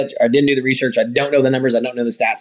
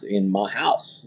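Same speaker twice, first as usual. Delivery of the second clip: "in my house" said softer and slowly, low; quiet slow emphasis; 4 semitones lower, 7 dB quieter, much slower than usual. Real, not acted. Rancher stressing that the guys came into his home, quiet slow emphasis. Repeating for weight.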